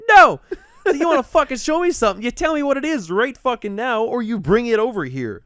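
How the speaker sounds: loud, high, indignant demand; retold angry refusal. Driver refusing to enter stranger's house, indignant. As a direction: act out putting your foot down loudly.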